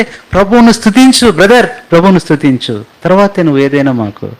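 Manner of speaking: a high, insistent greeting; emphatic greeting. Retold or imitated. Pastor insists the greeting come first, high and insistent. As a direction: push it as a rule to follow. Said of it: "అంటే".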